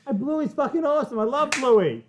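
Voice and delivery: high-pitched enthusiastic outburst; real excited fan enthusiasm; excited gushing. Real, not acted. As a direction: gush like a big fan. Gushes over a favourite show.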